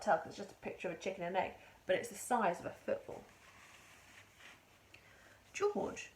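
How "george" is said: high called name; Mister Cranky calling. A narrator's reading. Mister Cranky shouting to George, excited. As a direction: call him over eagerly. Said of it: "Mister Cranky shouted".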